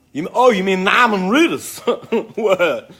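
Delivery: giggling, pretending not to know him; laughing disbelief. Real, not acted. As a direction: laugh it out in mock surprise. Mock surprise at fan's question.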